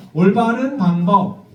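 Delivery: "올바른 방법" said slowly; slow model phrase. Shown as a demonstration. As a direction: lay out "올바른 방법" slowly for them to copy. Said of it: "그래서 이렇게 말하는 거예요".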